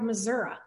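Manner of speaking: "Missouri" with an "uh" ending. Shown as a demonstration. Host picking her side, "Missouri" ending in "uh". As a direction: say "Missouri" as "muh-ZUR-uh". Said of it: "err on the side of".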